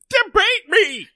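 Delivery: a pompous cartoon mayor bellowing a challenge; cartoon mayor roaring at a debate rival; mayor of Townsville. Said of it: "I kinda turned into the mayor of Townsville".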